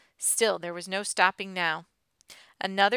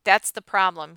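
loud, exasperated retort; forceful, frustrated comeback; near shout; 8 dB louder than usual. A narrator's reading. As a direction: fire it back, almost shouting. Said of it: "he practically shouted back at her".

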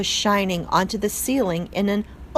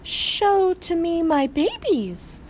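high, slow, French-tinged plea; French accent, drawn out and pleading; French mother mouse; 10 semitones higher, slower than usual. A narrator's reading. Mother mouse begging to see her newborns, French accent.

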